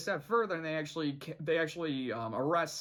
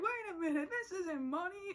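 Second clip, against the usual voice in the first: higher put-on townsperson; townsfolk voice; 14 semitones higher than usual. Shopkeeper realizing the IOU isn't money, lightly voiced. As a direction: play a shopkeeper catching on.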